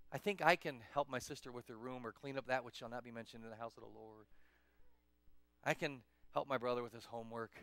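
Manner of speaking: low, settled sincerity; gentle earnest reflection; willing, humble thought. Acted. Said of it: "You're supposed to think".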